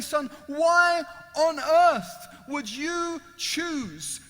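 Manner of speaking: loud, high, anguished questioning; impassioned plea. Acted. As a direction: stretch each phrase, begging the judge to explain. Freed prisoner questions the judge.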